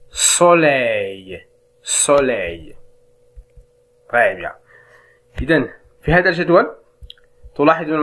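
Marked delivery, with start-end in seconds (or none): 0.1-2.7 "سوليي" drawn out very slowly, ending on the y glide; model pronunciation. Shown as a demonstration. Teacher showing how pupils say "soleil". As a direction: stretch "سوليي" so the ending is heard. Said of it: "ينطقون جميعا أي مثل".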